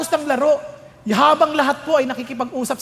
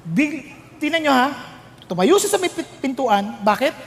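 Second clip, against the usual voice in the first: normal talking voice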